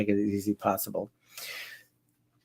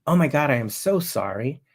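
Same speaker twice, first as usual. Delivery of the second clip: measured, sincere apology to a caller; mirroring concern; 3 semitones higher than usual. Shown as a demonstration. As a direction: show concern without gushing. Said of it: "first mirror them quickly".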